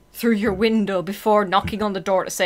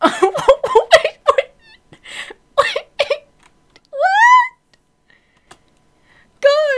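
high, loud incredulous yelling; shrill disbelief at the weirdos; freaked-out outburst; 7 dB louder than usual. Real, not acted. Reacting to weird neighbors.

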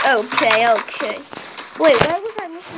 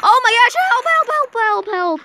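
high, rapid pleading; panicked call for help; 7 semitones higher than usual. Real, not acted. Calls for help in game.

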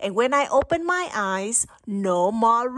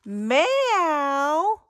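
ordinary delivery, nothing marked

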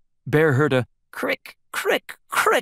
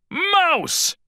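high alarmed call; crying out. A narrator's reading. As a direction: cry for Mouse in fright. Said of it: "he cried".

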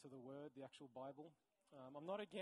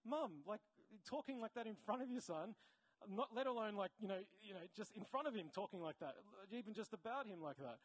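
raised, indignant disbelief, easing off; incredulous rebuke; 3 semitones higher, 4 dB louder than usual. Retold or imitated. Counselor to mum running down her son, incredulous. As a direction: pull up a parent in disbelief. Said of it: "And I was like".

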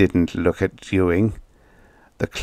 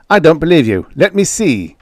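panicked demand; 8 semitones higher, 6 dB louder than usual. Acted. Joey grabs album.

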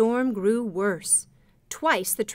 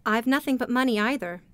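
ordinary delivery, nothing marked

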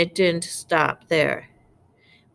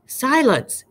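high-pitched exclaimed command; yelled order; 8 semitones higher, 3 dB louder than usual. A narrator's reading. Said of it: "yelled Vernon".

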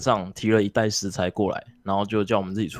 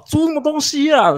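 shrieking high mock yell, much louder; teacher roaring; 6 dB louder than usual. Teacher yelling at a kid over the wrong dish, shrieking high yell. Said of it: "狂咆哮".

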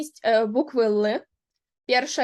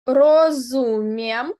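slow, loud teaching word; 7 dB louder, much slower than usual. Shown as a demonstration. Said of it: "slow teaching model".

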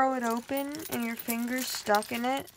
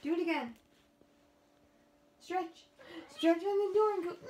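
coaxing the cat; 7 semitones higher, faster than usual. Real, not acted.

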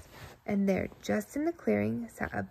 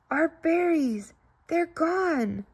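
hammed-up storybook dismay; dismayed cry; 6 semitones higher, much slower than usual. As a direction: wail over the lost berries. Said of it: "cried Bibby in dismay".

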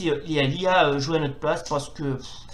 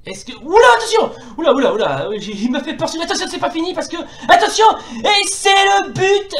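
excited goal call; 12 semitones higher, 7 dB louder than usual. Acted. Goal scored during commentary.

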